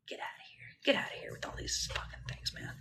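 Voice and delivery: strained, tearful whisper. Real, not acted. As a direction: push it out in a cracked whisper. Muttered dismissal after emotional pause.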